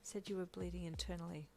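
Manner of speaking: soft and subdued. A narrator's reading. Hawkes reporting what the nurse said, softly. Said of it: "he said softly".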